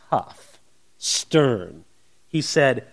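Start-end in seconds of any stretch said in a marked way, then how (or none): 0.1-1.9 stern. Shown as a demonstration. Describing Paul's stern letters.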